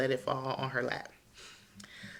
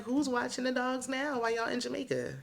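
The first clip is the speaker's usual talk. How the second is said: high mock-incredulous question; incredulous high squeal; 9 semitones higher than usual. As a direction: voice jumps way up in disbelief. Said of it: "I'm like".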